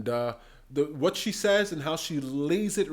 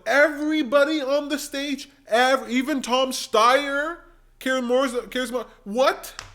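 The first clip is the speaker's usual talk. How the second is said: 1 louder rising rant; 8 semitones higher, 5 dB louder than usual. Real, not acted. Ranting at Warren.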